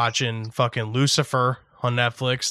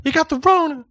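mock accusation; 13 semitones higher than usual. Teasing friend, high put-on voice.

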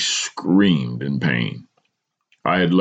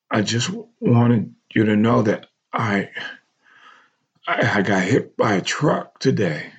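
stammering through pain and shock; halting, shaken; 8 semitones higher than usual. A narrator's reading. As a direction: force the words out, breaking off. Injured son calls his mother.

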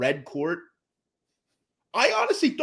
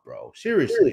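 ordinary delivery

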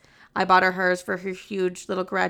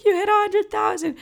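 much higher, tearful echo; high joyful crying voice; sister crying with joy; 14 semitones higher, 3 dB louder than usual. Retold or imitated. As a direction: cry it back at her sister. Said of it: "She's like".

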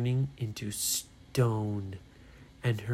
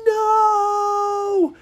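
long, high, loud cry; horrified shout; 10 dB louder than usual. Acted. Ryan sees Brady about to land, horrified shout. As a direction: shout it out to stop disaster.

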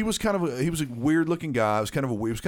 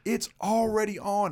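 higher retold call; mock call across the lot; 8 semitones higher than usual. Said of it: "and I was like".

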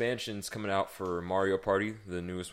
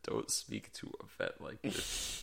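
slow, breathy mocking echo; mocking whisper; 6 semitones lower, 8 dB quieter, slower than usual. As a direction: whisper it back to tease him. Friend mockingly repeats his line.

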